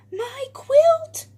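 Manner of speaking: high, loud cry of alarm; acted high-pitched wail; distressed cry. A narrator's reading. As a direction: cry out as a little quail losing a treasure. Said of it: "cries Quincy".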